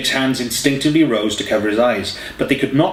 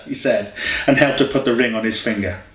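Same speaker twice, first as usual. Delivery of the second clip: plain reading voice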